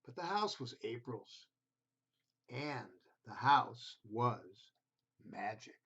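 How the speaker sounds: gentle storybook reading voice; reading to children. A narrator's reading. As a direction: read it like a bedtime picture book. Reads picture book to children.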